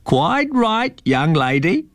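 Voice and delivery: put-on a high, knowing old eagle-man; old man's voice. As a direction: call down from the tree, teasing. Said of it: "came the old man's voice".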